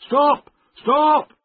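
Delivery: loud, high warning shout; two sharp, high-pitched yells; urgent cry. A narrator's reading. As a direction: cry out to halt them. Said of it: "he cried".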